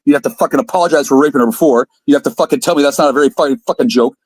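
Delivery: ordinary delivery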